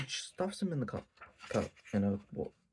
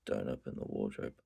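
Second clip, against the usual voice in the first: menacing low voice; 14 semitones lower, 4 dB quieter than usual. Shown as a demonstration. Yamai warning guests off the wardrobe, low and menacing. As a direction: drop the voice into a quiet threat. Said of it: "she doesn't say it like that".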